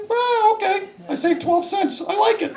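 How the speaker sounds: very high-pitched, squeaky mock delight; gleeful delight. Diner pleased to save twelve cents, gleeful and high. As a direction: gloat over a tiny saving. Acting pleased with senior menu deal.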